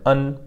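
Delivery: "un" between E and English un; pinyin EN sound. Shown as a demonstration. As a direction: say "un" with a light, half-swallowed N. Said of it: "So it's pronounced un".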